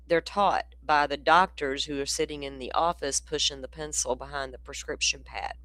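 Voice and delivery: usual speaking voice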